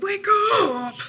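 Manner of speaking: high, loud, stretched call; alarmed cry. A narrator's reading. Bear waking Mouse in fright, high loud cry. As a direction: yell it high to rouse someone. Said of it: "he cried".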